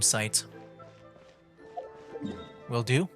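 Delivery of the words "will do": normal talking voice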